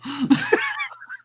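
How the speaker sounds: a burst of real high laughter. Real, not acted. Host laughing at his flustered co-host.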